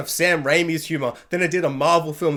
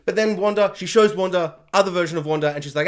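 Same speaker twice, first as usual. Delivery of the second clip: ordinary talk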